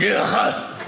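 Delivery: high-pitched mock scolding rant; hammed-up disgust at the younger generation; griping older relative. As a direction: groan and complain like an exasperated elder. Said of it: "various older members of my family walking up going".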